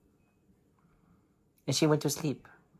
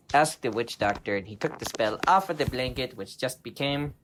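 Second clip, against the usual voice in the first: ordinary delivery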